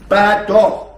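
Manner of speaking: loud, firm scolding bad dog. Shown as a demonstration. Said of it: "using a bad dog tone".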